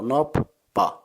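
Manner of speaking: "nop", a gap, then "pa"; syllable by syllable. Shown as a demonstration. Teacher putting the syllables back together, "nop, pa" clearly split. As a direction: separate "nop" from "pa" at the double consonant. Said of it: "And the second syllable".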